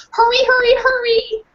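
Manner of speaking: high, loud yelping no; small dog crying no; dog protesting. Acted. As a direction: bark the no's like a desperate dog. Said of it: "all the barking".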